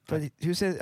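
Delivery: ordinary talk, nothing marked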